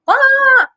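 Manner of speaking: high loud startled ah sound; mock lizard threat cry; skink scare hiss. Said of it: "he opens his mouth and goes".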